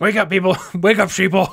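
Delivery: loud mock conspiracy rant; hammed-up paranoid yelling; conspiracy theorist.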